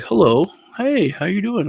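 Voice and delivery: put-on a sweet old grandfather waking the boys; imitating old grandpa. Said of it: "And he was like".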